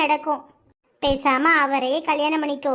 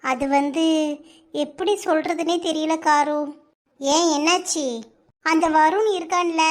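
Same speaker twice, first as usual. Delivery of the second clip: normal talking voice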